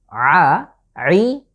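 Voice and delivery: ordinary talk, nothing marked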